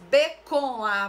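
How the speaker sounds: "B com A" loud, each letter set apart; emphatic spelling. Shown as a demonstration. Teacher asking what is heard, emphatic spelling. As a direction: sound out "B com A" forcefully. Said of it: "Só que quando eu falo".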